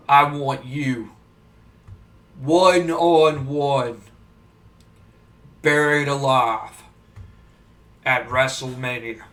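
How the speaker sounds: deep, slow, menacing Undertaker growl; Undertaker impression. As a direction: drag every phrase out low and ominous like the Undertaker. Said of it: "and Undertaker goes".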